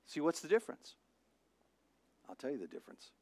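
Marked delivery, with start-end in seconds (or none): none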